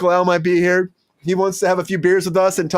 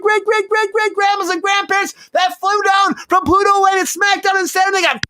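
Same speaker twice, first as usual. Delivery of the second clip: hyped rant; 7 semitones higher than usual. Acted. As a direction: whip the crowd into a frenzy.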